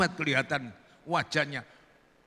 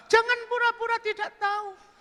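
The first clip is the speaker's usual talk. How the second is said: very high falsetto coaxing; coaxing woman. Acted. Woman coaxing Burhan to remember her, high falsetto. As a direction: coax him in high falsetto.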